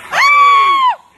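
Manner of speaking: high, loud startled scream; a sharp shriek of fright; sudden scream. Acted. Startled as possessed friend lunges. (চমকে ওঠা চিৎকার)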